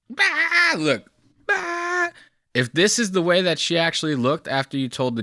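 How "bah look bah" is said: high, hammed-up yelp; mock startled shriek. Host laughing and mimicking the masseuse's shriek. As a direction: squeal it like a startled cartoon. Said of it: "she's literally like".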